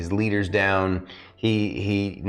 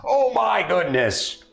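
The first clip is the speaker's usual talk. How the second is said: arena announcer; 11 semitones higher, slower than usual. Acted. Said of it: "announcer continues".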